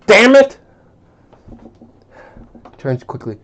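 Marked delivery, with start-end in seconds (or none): 0.1-0.6 loud, high yell at Mom; angry shout. Acted. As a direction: shout it out, furious.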